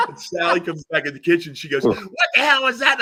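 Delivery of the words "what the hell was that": very high, loud mock outrage; incredulous outburst. Cafe owner confronting the babbling fan, incredulous. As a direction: demand an explanation in a high shout. Sally's baffled reaction to fan's babbling.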